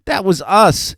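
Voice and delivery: put-on high, loud little-girl glee; delighted shout. A narrator's reading. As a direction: shout it like a thrilled child. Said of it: "Annie shouted with delight".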